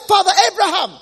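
a damned man shouting for mercy; pleading shout. Acted. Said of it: "he shouted and he said".

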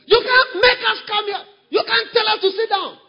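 high, loud mocking shout; hammed-up defiant yell; defiant member. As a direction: cry it out like a rebel who won't be ruled. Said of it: "That's an independent spirit".

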